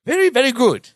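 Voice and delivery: put-on British accent. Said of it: "almost like it's in a British accent".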